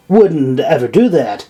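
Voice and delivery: plain reading voice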